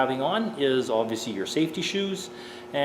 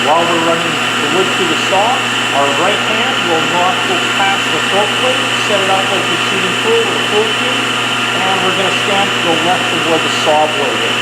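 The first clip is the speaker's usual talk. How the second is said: loud raised voice over machine noise; shouting over table saw; 6 semitones higher, 14 dB louder than usual. Real, not acted. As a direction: project your voice above the running saw. Instructor talking while saw runs.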